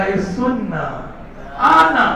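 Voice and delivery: soft wheedling, lilting up at the end; honey-sweet coaxing. Acted. Glucose luring reluctant ATP, honey-sweet coaxing. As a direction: coax as if honey were dripping. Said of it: "बहुत प्यार से बुलाया, मधु टपक रहा था".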